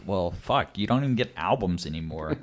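usual speaking voice

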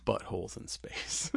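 deadpan unvoiced whisper. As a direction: whisper the joke flatly. Whispered joke answer.

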